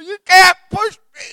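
shrieked, hammed-up refusal to be moved; very high and loud, like clinging on desperately; panicked protest. Clinging to the door, resisting.

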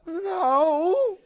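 a squeaky, laughing denial; high guilty laugh on no. Retold or imitated. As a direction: squeak out the lie through laughter. His lie to the sponsor.

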